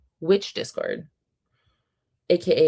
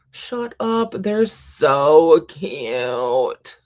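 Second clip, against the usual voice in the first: drawn-out gushing, nearly in tears; overcome by cuteness; 5 dB louder, much slower than usual. Real, not acted. Gushing at kitten photos, long tearful wail. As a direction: stretch the words out in helpless adoration. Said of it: "literally tearing up. I'm gonna cry".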